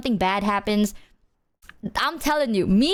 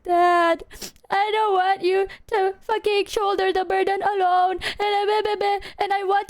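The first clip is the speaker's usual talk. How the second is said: higher, pleading; retold crying plea; 10 semitones higher than usual. Retelling crying to dad, a higher pleading voice. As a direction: beg Dad like through tears. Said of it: "I started, like, crying".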